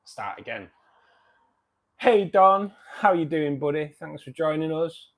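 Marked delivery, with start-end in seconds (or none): 2.0-5.1 cheery greeting. Real, not acted. Greeting a viewer brightly.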